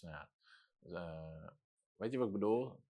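ordinary talk

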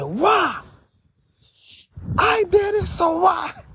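high silly yell in the street; mock yell. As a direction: belt out a goofy high cry.

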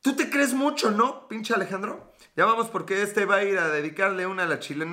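high mocking friend's voice; imitating a teasing friend. Friend teasing Alejandro about the Chilean girl, mock taunting. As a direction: needle him like a buddy calling him out. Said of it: "a mí me han dicho".

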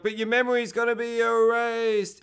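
high, stretched-out retold warning; mock dismayed wail. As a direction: imitate someone calling after a man slipping away. Doctor calls as he goes under.

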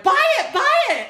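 high, loud excited cries; retold urging. Friends urging her to buy the tickets, excited high yell. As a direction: imitate friends egging you on, voice leaping up.

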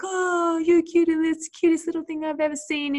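put-on high, loud cooing baby talk. Gushing baby talk to a little puppy. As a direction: gush over a baby like it's the cutest thing alive. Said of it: "you get a sort of different tone in your voice".

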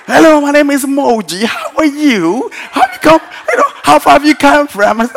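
high, loud, hammed-up English politeness; mock-British hostess with swooping pitch; Moji's British accent. Preacher imitating his helper chatting to the guest, exaggerated British accent. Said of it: "Moji was doing the Eng-, English thing".

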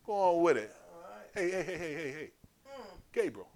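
ordinary talk, nothing marked

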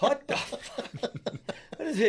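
drawn out and high, laughing through it; slow astonished what the fuck, cracking up; stunned laughing disbelief. Retold or imitated. Telling friends about the truck dent, laughing disbelief. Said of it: "I was like".

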